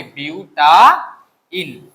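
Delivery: Butyne stretched out very slowly; slow model word. Shown as a demonstration. Teacher to students, modelling butyne very slowly. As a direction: drag out butyne syllable by syllable. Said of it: "আমি যেভাবে বলি এইভাবে করো".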